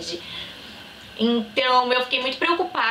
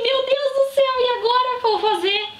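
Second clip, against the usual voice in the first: high, louder panicked outburst; panicked cry; 13 semitones higher, 3 dB louder than usual. Retold or imitated. Girl panicking alone in school bathroom, high panicked cry. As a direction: cry out in sudden shock and despair. Panicking after positive pregnancy test.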